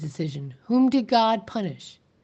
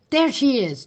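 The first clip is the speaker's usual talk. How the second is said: Trout shouting at the schoolteacher, high and loud; a mob leader's accusing shout; 6 semitones higher, 4 dB louder than usual. A narrator's reading. Trout points her out to the mob, shouting. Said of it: "Trout shouted".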